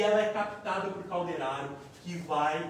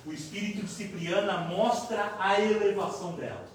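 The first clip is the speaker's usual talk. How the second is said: ordinary talk, nothing marked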